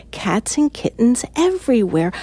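usual speaking voice

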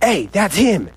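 half-yelled call across reception; retold prison yell. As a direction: imitate an inmate calling someone out. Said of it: "People are just yelling and screaming".

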